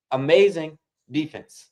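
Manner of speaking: slow, savoring amazing defense; drawn-out praise. Real, not acted. Streamer praising the Fever defense, slow savoring. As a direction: stretch it out admiringly.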